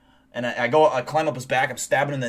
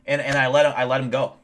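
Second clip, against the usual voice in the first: normal talking voice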